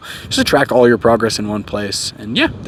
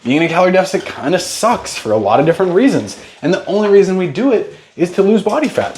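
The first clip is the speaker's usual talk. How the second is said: ordinary talk, nothing marked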